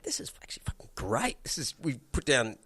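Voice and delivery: hushed band whisper. Retold or imitated. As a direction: whisper it in awe. Band excited in hushed awe.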